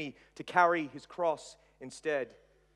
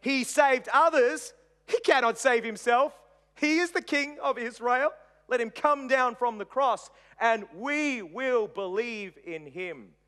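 high, raised-voice scornful taunt; preacher voicing mocking priests; 7 semitones higher, 5 dB louder than usual. A narrator's reading. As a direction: jeer at him, pitched up. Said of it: "scribes mocked him, saying".

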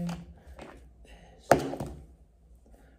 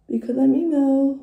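mock emo; 6 semitones higher, 9 dB louder than usual. Joke, then "Joking.

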